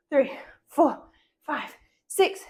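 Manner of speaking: usual speaking voice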